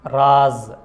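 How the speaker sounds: راز loud, halting on a bare z; vowelless final z. Shown as a demonstration. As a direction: brake on the z of راز, no vowel after it. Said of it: "ایک دم اس پہ بریک کر دیتے ہیں".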